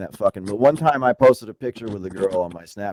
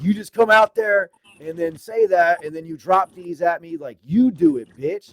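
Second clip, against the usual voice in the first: retold anger; 8 semitones higher, 5 dB louder than usual. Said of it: "He's like".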